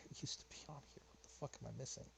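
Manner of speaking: hushed whisper to himself while searching. Real, not acted. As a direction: breathe it out under the voice, half to himself. Searching files, muttering to himself.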